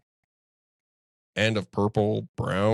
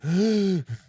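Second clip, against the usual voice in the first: sharp, high gasp for air; mocking the worn-out challenger's gasp; imitated gasp; 9 semitones higher, 4 dB louder than usual. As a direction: gasp like a winded man. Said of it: "he was just like".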